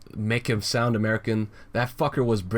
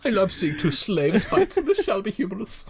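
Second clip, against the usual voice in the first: higher, slowed haughty toff voice; pompous aristocrat; 11 semitones higher than usual. Retold or imitated. Joking about old aristocrats, haughty aristocrat voice.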